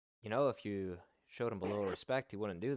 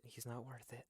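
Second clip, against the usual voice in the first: much softer, under the breath; hushed repeat; 3 semitones higher, 13 dB quieter than usual. Acted. Talking someone down from a fight, hushed. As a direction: drop it right down, almost to yourself.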